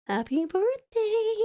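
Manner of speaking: slow, high, put-on little voice; high birthday coo. Sister repeating birthday wishes to her brother, high coo. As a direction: draw it out high, without shouting.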